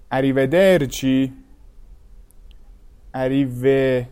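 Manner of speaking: تلفظ ایتالیایی اریودرچی با e باز; Italian arrivederci. Shown as a demonstration. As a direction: model اریودرچی for learners, e as in eh not ee.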